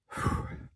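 tired huffing sigh; a heavy puff of breath from the heat. Real, not acted. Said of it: "I'm so sorry for all the huffing and puffing".

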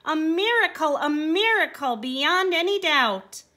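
high, loud, amazed shout; crying out in joy. A narrator's reading. Donkey crying out his joy at being healed. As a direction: shout the wonder of it. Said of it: "the donkey cried out".

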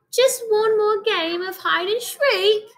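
put-on high pleading British child voice; British accent. Baby mummy pleads with his mother in a British accent. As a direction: beg in a little British voice. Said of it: "read it in a British accent whenever the little mummy talks".

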